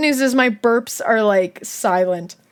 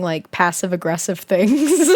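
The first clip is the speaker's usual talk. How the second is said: usual speaking voice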